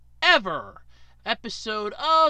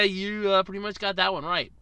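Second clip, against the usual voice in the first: usual speaking voice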